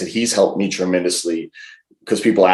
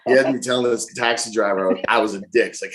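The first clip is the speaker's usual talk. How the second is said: ordinary delivery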